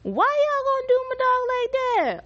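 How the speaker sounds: high, loud anguished wail; outraged cry. Retold or imitated. Viewer wailing at the screen, high and loud. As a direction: wail at the show in disbelief. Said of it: "I said".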